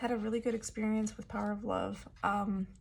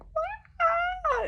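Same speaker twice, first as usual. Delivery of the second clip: very high, loud shriek; imitated excited scream; 7 dB louder than usual. Fan screams in trader's face over [name], imitated shriek. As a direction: mimic a fan's piercing scream. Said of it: "she screams in my face".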